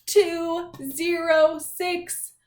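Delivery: slow, drawn-out numbers, each one stretched; announcing a room number, slow and loud; slow room number call. Shown as a demonstration. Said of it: "when I say your class's room number".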